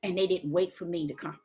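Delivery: God answering Deborah, low and calm. Pretend. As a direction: speak as a patient God. God speaking to her.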